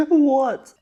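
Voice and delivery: very high, loud squeak of disbelief; incredulous squeal. Real, not acted. Vlogger baffled by painfully spicy soup, incredulous squeal. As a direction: squeal it out between laughing and coughing.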